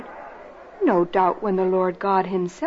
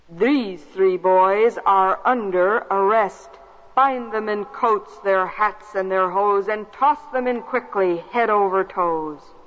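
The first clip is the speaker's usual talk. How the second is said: commanding king; 3 semitones lower, 3 dB louder than usual. Acted. King orders arrest.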